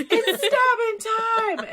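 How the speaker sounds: high, drawn-out battle cry; excited shout. Acted. Gemini descends on Brock as a cloud of daggers, shouting. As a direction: shout it slowly and high, relishing it. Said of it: "as Gemini shouts".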